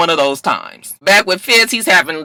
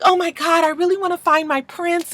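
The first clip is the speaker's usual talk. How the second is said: put-on high, airy girly-girl voice, much higher; 13 semitones higher, 4 dB quieter than usual. Quinn on a dating show, gushing in a girly voice. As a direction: gush like a starry-eyed reality show contestant. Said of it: "she's very girly and very like".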